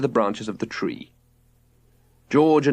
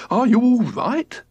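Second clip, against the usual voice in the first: higher and slow; alarmed cry; 12 semitones higher, 3 dB louder, slower than usual. A narrator's reading. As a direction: call out in alarm to his wife. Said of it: "cried George".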